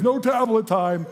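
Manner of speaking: high, loud, slow mock dad shout; hammed-up helpless yell at the kids. Dad lying still with vertigo, shouting at his boys in imitation. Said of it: "shouting at my boys".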